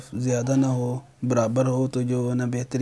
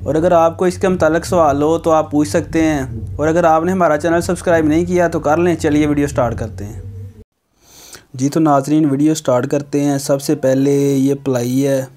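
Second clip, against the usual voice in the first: louder, upbeat presenter read for the video intro; channel intro voice; 4 semitones higher, 7 dB louder than usual. Acted. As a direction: pitch it like a host opening the show to viewers. Energetic YouTube intro voice. (जोशीले अंदाज़ में video की शुरुआत)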